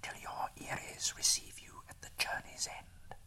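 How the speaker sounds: soft, far-off whisper; eagles' farewell. A narrator's reading. Eagles calling farewell as they fly off, whispered. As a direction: breathe it like a call fading in the wind. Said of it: "they cried".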